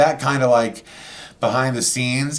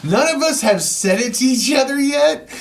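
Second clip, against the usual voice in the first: excited storytelling; 9 semitones higher than usual. Real, not acted. Telling friends the big moment, excited. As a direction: rush into the tale with excitement.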